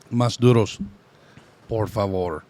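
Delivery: lightly Spanglish restaurant order; a hint of Spanglish accent. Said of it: "saying English words with a Spanglish accent".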